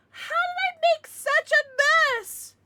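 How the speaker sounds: very high, slow, loud whine; mock dramatic. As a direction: ham up a squeaky lament. Mock dramatic line, then joking.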